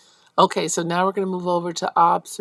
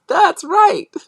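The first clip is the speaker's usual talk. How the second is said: laughing praise; 13 semitones higher, 8 dB louder than usual. Real, not acted. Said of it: "laughing praise".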